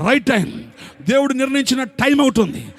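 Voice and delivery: high, loud, fervent sermon climax; preaching at full force. Acted. Pastor to congregation, fervent loud preaching.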